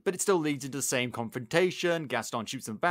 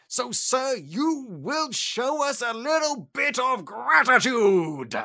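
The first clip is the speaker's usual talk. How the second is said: high, loud scolding butler voice, often slow; prim servant's outraged speech; indignant butler; 8 semitones higher, 4 dB louder than usual. Retold or imitated. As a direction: lecture your master like a fed-up butler. Cogsworth scolds the Beast.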